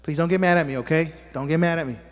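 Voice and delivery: usual speaking voice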